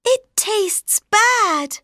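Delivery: put-on high, slow, disgusted girl voice; lightly voiced grimacing girl. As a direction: pull a face at the taste. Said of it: "she said".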